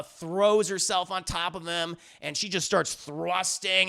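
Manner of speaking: ordinary talk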